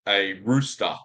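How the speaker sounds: "rooster" ending in an open "ah"; Australian "rooster", R dropped at the end; non-rhotic pronunciation. Shown as a demonstration. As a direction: say "rooster" without the final R. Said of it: "But I say a rooster".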